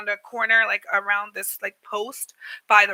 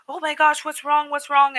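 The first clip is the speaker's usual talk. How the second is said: retold higher-pitched worried outburst; alarmed bystander; 4 semitones higher than usual. Shop lady checks on an upset customer, alarmed. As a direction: imitate a concerned woman rushing to help.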